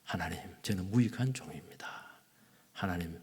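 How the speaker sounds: hushed, breathy whisper of a humble servant; whispered prayer. Retold or imitated. Preacher voicing a servant praying before sleep, whispering. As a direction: murmur it low like a bedtime prayer.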